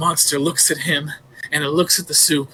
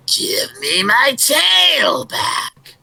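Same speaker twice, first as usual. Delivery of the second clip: higher, slow drawn-out howl; spooky monster shout; 8 semitones higher, 4 dB louder, much slower than usual. Acted. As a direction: howl each word long and loud. Creature calling from the woods.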